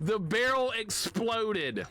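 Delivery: slow, high wail after laughing; amused disbelief. Real, not acted. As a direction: stretch it out high, incredulous. Repeats mishap after laughing fit.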